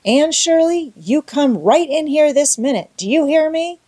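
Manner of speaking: high, loud call across the yard. Acted. Marilla calling Anne inside.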